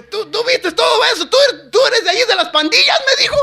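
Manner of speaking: high, loud, stammered disbelief; mimicked astonishment. Coworker asking about gangs, mimicked loud high amazement. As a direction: fire off mock questions loud and high.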